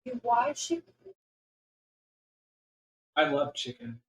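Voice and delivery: usual speaking voice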